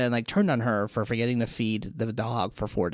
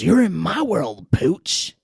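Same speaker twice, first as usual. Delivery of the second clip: menacing villain line; 4 semitones higher, 5 dB louder, much slower than usual. Retold or imitated. Kathy Bates' character catching the dog.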